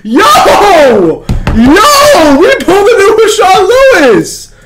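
high, loud scream of disbelief; ecstatic shouting. Real, not acted. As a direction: lose it over a jackpot pull. Pulls a rare card on stream.

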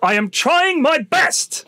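slow, strained, put-upon plea; imitating the driver. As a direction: drag it out like a harassed driver at his limit. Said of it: "That's what he says".

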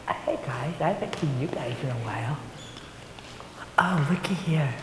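put-on hushed, secret greeting to the children; whisper. Teacher whispering hello to the children at storytime. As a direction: lean in and whisper to the kids.

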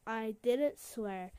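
ordinary talk

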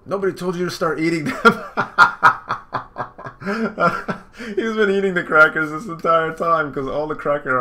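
laughing through the words; laughing at Luffy eating crackers. Real, not acted. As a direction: crack up while saying it.

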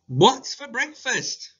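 put-on slow, high child asking about breakfast; child's eager question. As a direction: ask like an excited kid. Child voiced in story.

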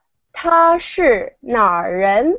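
slow careful Mandarin, "他是哪人?" with clear tones; 慢速清晰示范; model Mandarin phrase. Shown as a demonstration. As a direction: say "他是哪人?" slowly like a teacher modeling. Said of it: "the way to ask where someone is from, you say".

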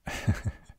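short, breathy chuckle; a little laugh through the breath; amused chuckle. Acted. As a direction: chuckle briefly at their idea. Amused by companion's idea.

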